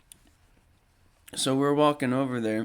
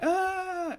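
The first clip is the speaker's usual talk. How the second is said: long, high thin "aah"; imitated crowd scream. Everybody as the band walks out, mock high scream. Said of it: "everybody's going like".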